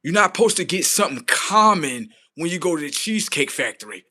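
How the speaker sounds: normal talking voice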